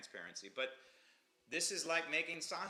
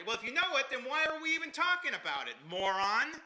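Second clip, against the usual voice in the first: much higher, louder exasperated outburst; exasperated retort; 12 semitones higher, 8 dB louder than usual. Retold or imitated. Answering the CNN anchor with a loud exasperated retort. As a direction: fire it back, fed up, ending on moron.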